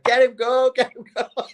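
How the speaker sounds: high-pitched mock cheering; high, playful hollering impression of encouragement; cheering her on. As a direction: imitate rowdy girls egging a friend on. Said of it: "They're encouraging you".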